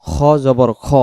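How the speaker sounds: খ জবর খ with a thick "kho"; heavy rounded vowel. Shown as a demonstration. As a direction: round খ to "o", not open "a". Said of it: "খা পড়া যাবে না".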